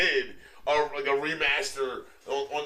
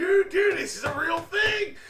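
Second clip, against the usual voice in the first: much higher, louder protest; insistent outburst; 5 dB louder than usual. Real, not acted. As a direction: shout it, they must believe you. Insisting the game is real.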